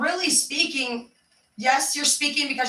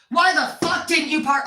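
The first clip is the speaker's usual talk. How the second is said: raised, higher-pitched confrontation; angry; 5 semitones higher, 5 dB louder than usual. Acted. Someone walking in on a talk, angry interruption. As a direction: burst in loudly demanding an answer. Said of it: "you're rude or angry".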